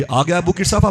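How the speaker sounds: high-pitched mock-angry greeting; sharp rising challenge from an angry aunt. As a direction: pounce on him as the cross aunt. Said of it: "खाला मेरी बड़ी गजब में थी".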